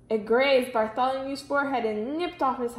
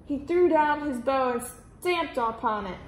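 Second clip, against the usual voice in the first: usual speaking voice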